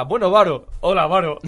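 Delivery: calling out the name loudly, hammed up; loud, teasing, repeated hello; loud mock greeting. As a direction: hail him like old pals across the room. Friends greeting him on air.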